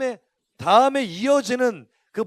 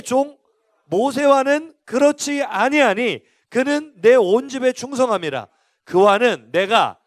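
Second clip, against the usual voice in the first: loud, slow proclamation of the verses; scripture reading; 5 dB louder than usual. Acted. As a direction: declare God's words with force. Reads God's words from Numbers. (크고 느린 성경 선포)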